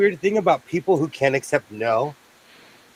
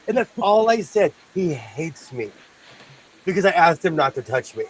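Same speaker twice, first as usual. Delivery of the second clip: usual speaking voice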